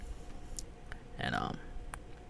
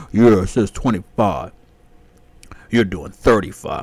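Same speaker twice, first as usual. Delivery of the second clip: louder, slow, dopey husband voice; mock husband; 3 semitones lower, 7 dB louder, slower than usual. Husband backing up his wife, louder slow mock voice. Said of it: "her fat husband over there back".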